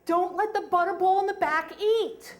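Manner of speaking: mock cowgirl shout; cowgirl calling out. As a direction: yell it down the trail line like a trail guide. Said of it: "from the cowgirl up front".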